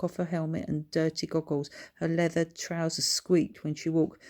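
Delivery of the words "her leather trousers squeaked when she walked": usual speaking voice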